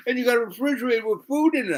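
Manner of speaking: high, rapid imitation of an amazed girl. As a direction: rush it out in high-pitched wonder. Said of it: "She goes".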